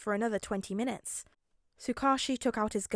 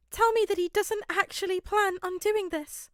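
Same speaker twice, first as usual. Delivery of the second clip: a much higher, louder desperate plea; pleading; 10 semitones higher, 5 dB louder than usual. Acted. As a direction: beg him to say it isn't real. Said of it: "Nezu pleaded".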